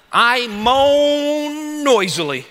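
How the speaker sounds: long drawn-out groan on MOAN; mock moaning. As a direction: act out a noisy moan, stretched slow. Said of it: "David's moaning".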